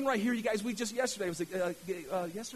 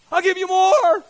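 high, very loud shout as God; booming proclamation; 11 semitones higher, 18 dB louder than usual. Acted. God answering the faithful giver, booming proclamation. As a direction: shout God's generous answer out to the room. Repeats God's answer, louder still.

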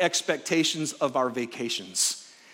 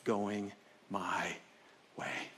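quiet inner complaint; 8 semitones lower, 10 dB quieter, much slower than usual. Retold or imitated. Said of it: "His internal issue was".

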